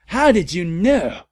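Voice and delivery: high, loud, slow astonished question; hammed-up surprise; surprised. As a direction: gasp out the question. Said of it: "I asked in a surprised way".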